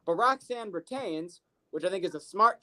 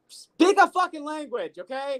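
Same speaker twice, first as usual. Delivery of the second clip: exasperated; 6 semitones higher, 5 dB louder than usual. Retold or imitated. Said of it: "And I was like".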